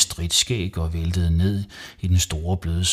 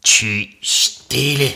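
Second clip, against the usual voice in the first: loud, high, slow hushing order; snorted rebuke; 6 semitones higher, 6 dB louder, slower than usual. A narrator's reading. Mum cutting off Savi's excuse, snorting. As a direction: snort it out crossly. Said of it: "fnøs mor".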